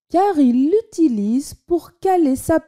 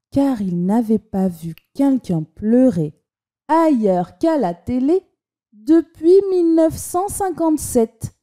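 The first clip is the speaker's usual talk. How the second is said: ordinary delivery, nothing marked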